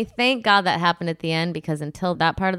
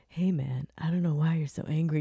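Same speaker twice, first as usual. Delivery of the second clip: soft, placating; mock calm; 5 semitones lower, 8 dB quieter than usual. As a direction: lower and softer, calming him down. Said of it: "He was like".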